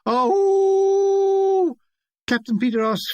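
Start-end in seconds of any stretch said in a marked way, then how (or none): 0.1-1.8 a long, high, far-off howl; unearthly howling. A narrator's reading. Narrator mimics the camp madman's distant howl. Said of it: "came the unearthly howling".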